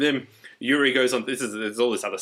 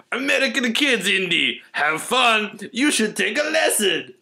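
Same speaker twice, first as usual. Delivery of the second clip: put-on high, slow hammy Russian accent; mock Russian friend, high and slow; 8 semitones higher, 3 dB louder, slower than usual. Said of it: "has a Russian accent".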